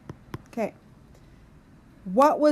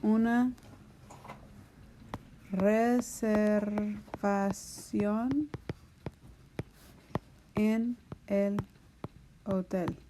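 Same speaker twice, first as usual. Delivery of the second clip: slow dictation; 4 dB quieter, much slower than usual. Shown as a demonstration. Dictating while writing on board.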